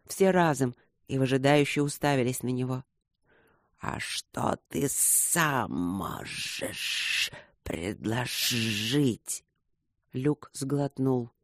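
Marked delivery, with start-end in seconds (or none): 3.8-9.4 low, soft, very slow hiss; sly serpent. A narrator's reading. Serpents ask Luke for an offer, low slow hiss. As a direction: draw the question out sinuously.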